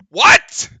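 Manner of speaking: loud high shocked what; outraged what. Real, not acted. Streamer seeing the replay, loud outrage. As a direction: throw it out in disbelief. Reacting to the call again.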